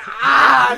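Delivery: loud, high mock scream; hammed-up scream with laughter in it; horrified scream. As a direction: scream in comic horror. Retold horror at ripped-out eye.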